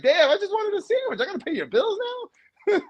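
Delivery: a guy who only wanted a sandwich, voice shooting up in disbelief; incredulous high whine. Retold or imitated. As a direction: squeak it out like you can't believe it. Exasperated guy quoted.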